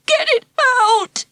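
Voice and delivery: high tearful whimper; plead, close to crying. Acted. Ralph to Jane, whimpering while she holds the mouse. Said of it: "he whimpered".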